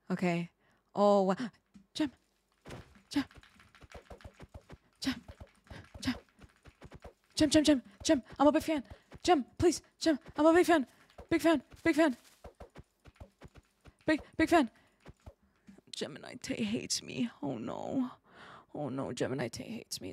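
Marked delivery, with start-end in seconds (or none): 1.9-14.7 high, insistent little fan calls; pleading fan. Pretend. 15.9-20.1 hushed, whispered mock despair; dejected whisper. Mock despair at being ignored.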